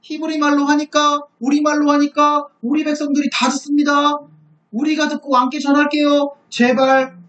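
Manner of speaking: high, held-pitch whiny begging; servile pleading. Retold or imitated. Envoys begging him to speak the other language, servile. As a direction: plead abjectly as a cowed envoy. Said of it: "이렇게 비굴하게 얘기를 했어요".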